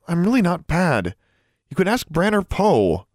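high, earnest plea; anxious girl's appeal; pleading. A narrator's reading. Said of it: "she continued pleading with the house elf".

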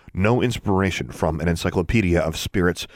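usual speaking voice

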